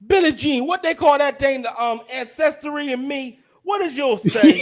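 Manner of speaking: high, loud put-on voice; put-on character voice. Friend asking about an ancestry test, put-on high voice. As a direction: play a nosy, high-pitched busybody.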